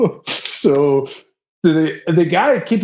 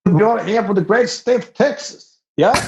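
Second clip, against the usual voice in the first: high-pitched put-on Texas accent; 8 semitones higher than usual. Performing for the host on request, high Texas accent. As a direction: do a playful Texas welcome, pitched up. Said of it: "do your Texas accent one more time".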